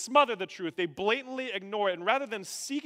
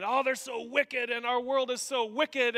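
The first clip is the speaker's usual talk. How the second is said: higher, heated lament over the wicked; heated agreement; 7 semitones higher, 3 dB louder than usual. Acted. Voicing the original readers agreeing heartily, heated agreement. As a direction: agree indignantly about those wicked people.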